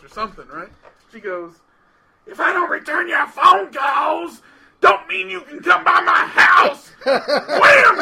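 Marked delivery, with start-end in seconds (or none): 2.3-6.9 high, drawn-out furious mock yell; loud, slow, high-pitched tirade; angry shouting. Client yells before slamming door. 7.1-8.0 explosive high "wham"; very loud, high exclamation; shouted slam noise. Retold or imitated. Imitates the door slam.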